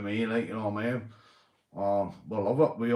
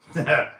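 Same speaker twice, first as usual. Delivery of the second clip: loud laugh; 5 semitones higher, 8 dB louder than usual. Real, not acted. Laughing after a joke.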